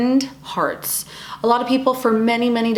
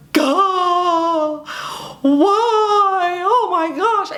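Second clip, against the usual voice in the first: mock teary outburst; 9 semitones higher, much slower than usual. Woman overwhelmed by emotions on a bad day, high crying wail. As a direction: wail it like everything just set you off. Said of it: "the wrong thing goes and you're like".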